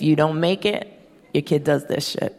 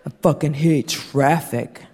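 grumpy husband; slower than usual. Retold or imitated. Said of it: "He was in the worst mood".

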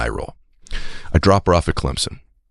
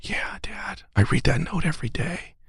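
high, much softer whispered confession; 13 semitones higher, 8 dB quieter, slower than usual. Retold or imitated. Daughter confessing to dad, soft whisper. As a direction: admit it shyly and softly. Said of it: "she goes".

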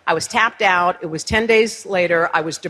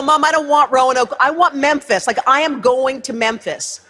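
excited; 5 semitones higher than usual. Retold or imitated. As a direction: gush the news to mom. Excitedly tells mom on phone.

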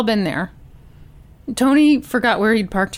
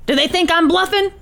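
higher, pressing question from a gunman; lightly acted hostage-taker demanding an answer; Tony; 7 semitones higher than usual.

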